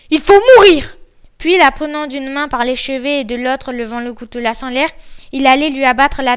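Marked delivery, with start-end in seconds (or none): 0.1-1.0 high, very loud verdict; shouted death sentence. Acted. Bluebeard tells his wife she must die, shouting. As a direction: yell the sentence at her.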